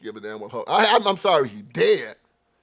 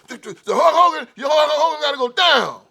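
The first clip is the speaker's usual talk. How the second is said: mock angry crowd; 10 semitones higher, 3 dB louder than usual. Voicing angry crowd demanding punishment.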